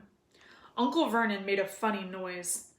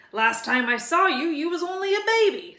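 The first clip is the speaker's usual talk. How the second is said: lightly voiced booming giant, friendly; a big friendly giant asking for tea; gruff giant; 7 semitones higher, 6 dB louder than usual. A narrator's reading. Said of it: "said the giant".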